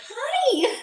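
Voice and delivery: very high cheery hi; overly nice Midwesterner. Retold or imitated. Grocery store clerk greeting her, very high chirpy hi. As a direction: chirp it like a friendly cashier. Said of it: "they were like".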